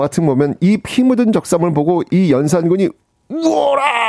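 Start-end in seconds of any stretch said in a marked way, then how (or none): 3.3-4.0 high-pitched, drawn-out dramatic roar; acted enraged cry. Yeonsangun sees the bloodstained garment, mock enraged cry. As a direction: imitate a drama king erupting in rage. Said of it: "하면서 그냥 막 열받아하는".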